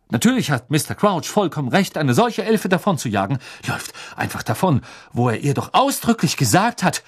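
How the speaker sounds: righteous official defending his boss; indignant. A narrator's reading. Pompous brother defending the ministry, indignant. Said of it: "warf sich Percy entrüstet in die Brust".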